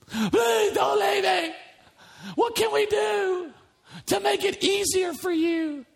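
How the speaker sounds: high, slow, pleading whine; mock begging plea. Pastor mocking a Jesus who begs followers to stay.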